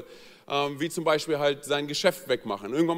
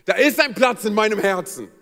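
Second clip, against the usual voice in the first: higher and louder, a hammed-up trembling plea; mock tearful plea; 4 semitones higher, 8 dB louder than usual. Wife begging husband for a pet, mock tearful. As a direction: play the wife's quivering-lip appeal. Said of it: "Unterlippe zittert und sie sagt".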